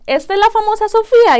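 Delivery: put-on a doctor thrilled to meet the miracle baby; excited. Doctor introducing the famous baby. (muy agudo, fuerte y rápido, con entusiasmo)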